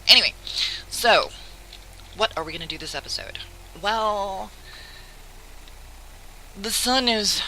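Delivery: normal talking voice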